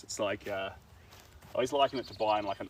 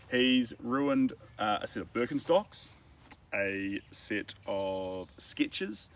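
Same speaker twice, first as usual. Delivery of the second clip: ordinary delivery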